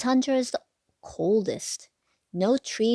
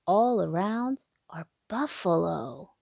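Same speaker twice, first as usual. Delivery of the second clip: put-on lilting sing-song read to little kids; bouncy storybook rhyme voice; reading to children; 4 semitones higher than usual. A narrator's reading. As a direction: read the rhyme like a bedtime picture book. Rhyming picture book read aloud.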